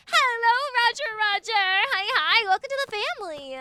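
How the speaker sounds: loud, high hello, easing slightly; excited welcome. Real, not acted. Streamer welcoming someone to the family, loud excited call. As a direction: belt out a big welcome.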